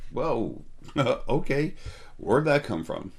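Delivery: surprised laugh. Retold or imitated. Surprised at his own words.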